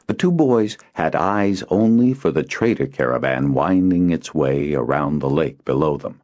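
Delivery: usual speaking voice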